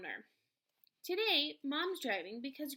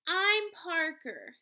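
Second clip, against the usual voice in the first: louder, slow "I'm Parker" with stress on "I'm"; quick put-in; 6 semitones higher, 9 dB louder, much slower than usual. A narrator's reading. Grandson reminding confused Grams of his name, louder. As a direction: firmly remind her who you are. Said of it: "I put in quickly".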